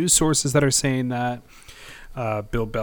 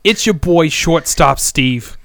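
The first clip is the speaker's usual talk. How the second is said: put-on louder, much higher Boston accent, slow on the name; 5 semitones higher, 6 dB louder than usual. Introducing himself as Shortstop Steve, hammed-up Boston accent. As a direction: announce yourself as a hammed-up Boston ballplayer. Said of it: "What is that accent?".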